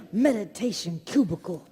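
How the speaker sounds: ordinary delivery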